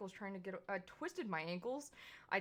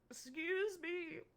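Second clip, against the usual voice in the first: high, slow gasp, then offended; gasp, then drag out the words in disbelief; shocked gasp; 9 semitones higher, much slower than usual. Acted. Offended gasp at being interrupted.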